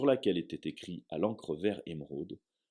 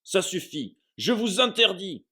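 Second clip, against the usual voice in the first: much higher, loud panicked shriek; 13 semitones higher, 8 dB louder than usual. Acted. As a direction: scream it in blind panic. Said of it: "s'exclama l'oncle Vernon, pris de panique".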